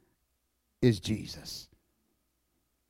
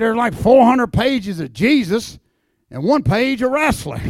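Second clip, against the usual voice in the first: gravel-voiced old timer; 5 semitones higher, 7 dB louder, slower than usual. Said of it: "in that old gravel voice".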